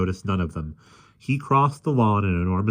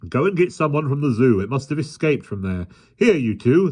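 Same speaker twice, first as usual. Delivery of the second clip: policeman; 7 semitones higher, 3 dB louder than usual. A narrator's reading. Policeman orders.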